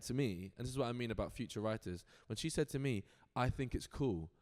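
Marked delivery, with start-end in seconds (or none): none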